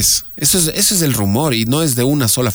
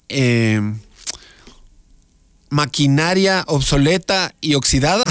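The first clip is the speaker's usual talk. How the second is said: slow, officious customs questions, higher pitch; mock customs officer; 3 semitones higher than usual. Host mimicking an airport customs officer, officious. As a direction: play a customs agent quizzing a minister.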